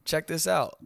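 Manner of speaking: hammed-up eager southerner; teasing mock co-host. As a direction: mimic a co-host showing off his twang. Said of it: "you even probably was like".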